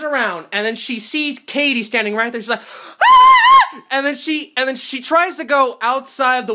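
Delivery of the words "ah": very high, loud mock scream; horror scream. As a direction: shriek like a terrified film heroine. Heroine sees Katie, screams.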